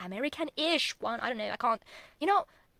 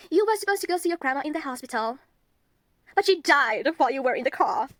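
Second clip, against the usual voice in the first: raised, louder retelling of mom; mom breaking bad news; 4 semitones higher, 7 dB louder than usual. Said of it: "And my mom's like".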